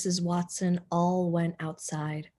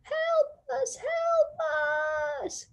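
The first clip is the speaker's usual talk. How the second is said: high, distant call for help; faraway cry; much slower than usual. A narrator's reading. The Watsons call from afar for help. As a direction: cry out from far off. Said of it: "shouted a faraway voice".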